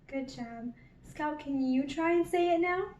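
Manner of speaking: ordinary talk, nothing marked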